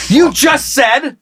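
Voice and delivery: high, slow, shouted accusation; mock outrage.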